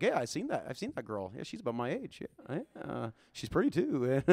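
put-on soft, low musing about the girl; David musing about Saul's daughter, quiet and sly; sly hushed aside. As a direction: murmur it to yourself with a grin. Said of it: "He's like".

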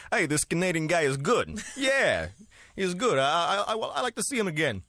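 lightly done Jersey tough guy; New Jersey accent. Pretend. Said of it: "your best New Jersey accent".

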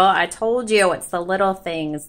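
normal talking voice

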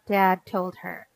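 plain reading voice

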